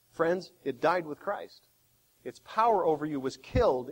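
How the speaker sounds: usual speaking voice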